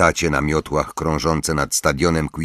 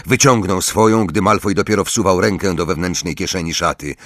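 ordinary delivery

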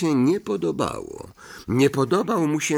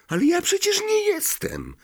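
frightened cry. Acted. Harry denies being Slytherin's descendant, a frightened high cry. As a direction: cry out in alarm, pitch leaping. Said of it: "krzyknął Harry, ogarnięty strachem".

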